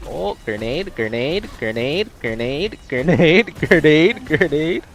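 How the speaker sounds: building, gleeful grenade chant; grenade warning yell. Real, not acted. Spamming grenades in a fight.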